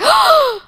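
loud, high theatrical gasp; sharp pretend gasp of shock; mock shocked gasp. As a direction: gasp as if scandalized, hammed up. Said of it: "like, gasp".

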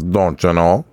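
a light, mock Midwestern mom voice; just a hint of a cartoon mom saying it; imitating Bobby's World mom. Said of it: "just like Bobby's World's mom".

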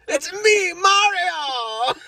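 very high mock Italian accent; Mario. Shown as a demonstration. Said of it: "I do a good Italian accent".

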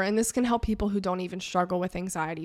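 usual speaking voice

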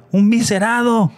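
high, drawn-out acted call; retold shout. Paramedic calling out on seeing the injured man, shouting. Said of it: "se voltea el paramédico y grita".

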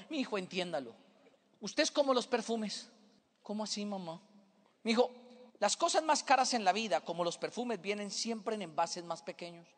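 soft, low motherly reassurance; gently consoling mother. Acted. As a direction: explain it tenderly to a tearful child. Mother consoling her crying son.

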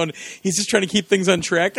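ordinary talk

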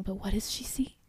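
whispered, building suspense. Real, not acted. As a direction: hush it like a cliffhanger. Dramatic suspense in recap.

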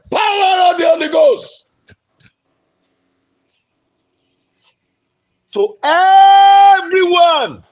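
high, loud stretched-out preaching shout; shouting prayer. Real, not acted. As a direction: roar it to the whole congregation.

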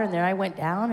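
ordinary talk, nothing marked

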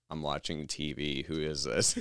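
low, soft, unhurried reply; calm and collected. Retold or imitated. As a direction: imitate someone coolly unbothered on the phone. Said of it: "he was so collected".

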